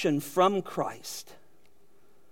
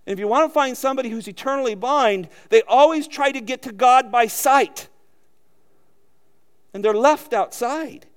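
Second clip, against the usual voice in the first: normal talking voice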